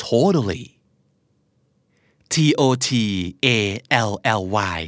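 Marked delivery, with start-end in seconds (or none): 0.1-0.6 "Totally" as "toh-duh-lee"; American flap T. Shown as a demonstration. Teacher introducing the next drill word, flap T. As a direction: tap the second T of "Totally" as a D. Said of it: "อเมริกันออกเสียงว่า".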